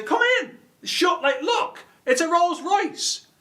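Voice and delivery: high-pitched, over-eager showing off; excited urging. Acted. Driver urging everyone else into the car, excited high voice. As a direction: squeal it like a thrilled recruiter.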